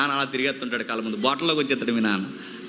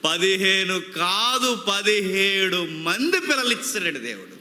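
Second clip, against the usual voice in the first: building count. Retold or imitated. Preacher voicing God's promise of children, building triumphantly. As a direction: count up steadily, stretching and lifting the last numbers.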